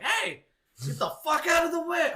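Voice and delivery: high, loud yell to clear the way. Retold or imitated. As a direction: bark at the kid in your path. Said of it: "I start yelling at him".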